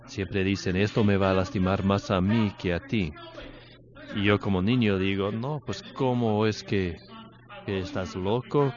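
loud, fervent preaching; preaching at full force. Acted. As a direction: drive the story home to the congregation. Preaches the father illustration. (predicación fuerte y apasionada)

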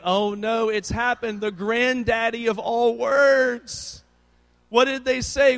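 high, loud comic horror building; mock panic. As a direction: wail it as if catastrophe has struck. Dad's mock panic at F word.

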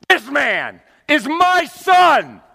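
high, loud and very slow; divine proclamation. Acted. God speaking from heaven, loud slow proclamation.

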